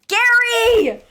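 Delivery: high, loud triumphant yell; shouting the name in delight; excited shout. Real, not acted. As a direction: crow that you called it. Guess proved right, excited.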